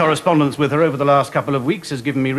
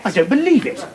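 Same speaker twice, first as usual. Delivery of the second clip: amazed disbelief; 8 semitones higher than usual. Acted. Gawping at the photograph.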